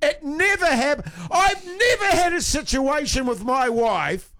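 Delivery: high, half-laughing shouted tirade; mock-outraged rant. Real, not acted. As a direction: rant at the fellas in mock fury. Escalating rant about the sulking claim.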